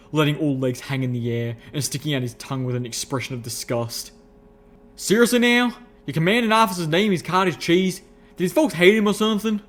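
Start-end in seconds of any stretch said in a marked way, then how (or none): 5.0-9.6 high, loud folksy incredulity; Calamity in disbelief. A narrator's reading. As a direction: hoot it in rustic disbelief. Calamity mocks the elder's name.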